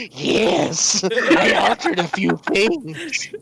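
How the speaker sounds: Peter Lorre voice. Retold or imitated. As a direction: purr it out like a sinister old-movie character. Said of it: "Peter Lorre?".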